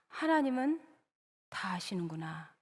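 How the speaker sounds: gasp, then soft voice sinking into a whisper; hushed realisation. Acted. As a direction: act a stunned, whispered realisation. Sarah realises God knows all. (숨을 들이켜고 속삭이듯 깨달으며)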